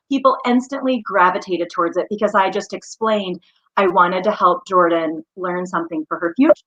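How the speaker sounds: normal talking voice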